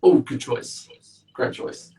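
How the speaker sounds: ordinary delivery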